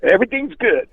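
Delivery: high, fast, overly sweet reassurance; hammed-up niceness, trying to smooth it over; mock nice. Singer mocking his own fake-nice apology to the actress, high and quick. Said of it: "I'm trying to be nice".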